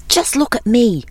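high-pitched mild brag; boasting. A narrator's reading. Hare showing off to the other animals, boasting. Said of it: "he was boasting one evening".

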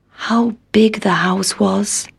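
slow, hushed wonder; awed whisper. A narrator's reading. Country Mouse arriving at the big house, awed whisper. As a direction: whisper it slowly, in awe.

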